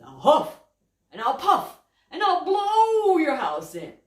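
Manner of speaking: loud building wolf threat. Acted. Wolf threatening the pig's house, loud. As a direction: huff and puff it big. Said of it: "He says".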